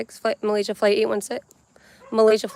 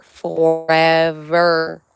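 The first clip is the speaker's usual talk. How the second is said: slow, drawn-out forever; Sandlot forever; 3 semitones lower, 4 dB louder, much slower than usual. Retold or imitated. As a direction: stretch it out, lower and slow. Have you ever watched that from The Sandlot?.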